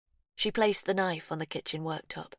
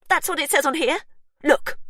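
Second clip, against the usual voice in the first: higher, much louder furious demand; angry shout; 11 semitones higher, 9 dB louder than usual. Acted. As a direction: shove the proof in his face. Sister shouting, waving the certificate.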